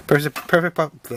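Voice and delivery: "perfect, perfect pu-" slurred and tripped over; mumbling. Real, not acted. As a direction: stumble over "perfect" and cut off "pu-". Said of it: "ugh, I'm mumbling".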